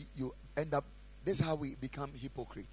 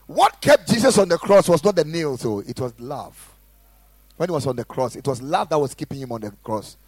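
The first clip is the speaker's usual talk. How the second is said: full-force preaching; 17 dB louder than usual. Acted. Pastor exhorting the congregation, loud fervent preaching. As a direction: preach it hard to the room. Preaching loudly about the cross.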